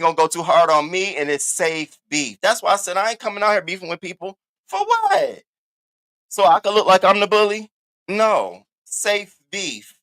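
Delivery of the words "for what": incredulous squeak. Real, not acted. Incredulous question.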